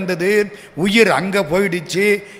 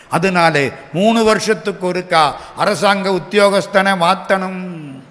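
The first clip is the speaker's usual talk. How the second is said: normal talking voice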